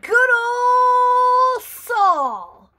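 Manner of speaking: high, loud, very slow greeting; drawn-out call. Real, not acted. Grandma calls out a greeting to Saul, long and loud. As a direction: stretch his name out big.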